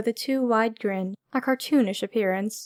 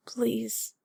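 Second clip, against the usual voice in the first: soft unvoiced whisper; whispered plea; 8 dB quieter than usual. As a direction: breathe the word as a plea. Pleading after hesitation.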